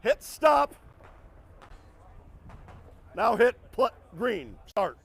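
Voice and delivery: loud, firm range commands. Real, not acted. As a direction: call it clearly across the range. Range officer timer commands.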